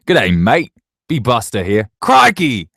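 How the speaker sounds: big loud mock-Australian greeting; horrible Australian accent. Shown as a demonstration. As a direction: do a bad, over-the-top Australian accent. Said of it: "Can I do any accents?".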